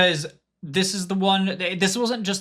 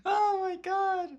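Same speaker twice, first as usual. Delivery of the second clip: stunned disbelief; 3 dB quieter, slower than usual. Real, not acted. Reacting to bits message.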